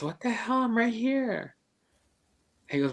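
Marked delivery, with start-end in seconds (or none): 0.0-1.5 startled protest. Retold or imitated. Nephew protests again.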